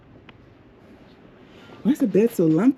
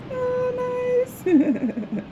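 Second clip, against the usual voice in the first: very high, drawn-out oh dropping down; delighted coo. Real, not acted. Traveler admiring her balcony, cooing with delight. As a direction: coo it high, then let it fall.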